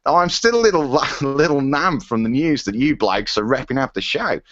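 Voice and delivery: put-on broad comic Aussie accent, breaking into a laugh; silly Australian accent with giggles. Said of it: "I'm losing it now".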